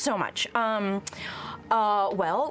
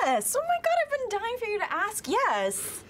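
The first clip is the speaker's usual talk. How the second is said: high, fast, thrilled gush; excited; 12 semitones higher than usual. Acted. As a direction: squeal with delight. Player thrilled at offer.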